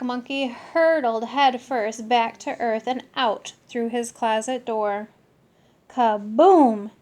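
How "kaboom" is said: loud booming holler; louder explosive yell. Acted. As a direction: shout the crash. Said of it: "he hollered".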